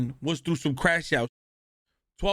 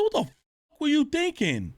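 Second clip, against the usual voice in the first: retold outrage; 8 semitones higher than usual. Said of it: "I said".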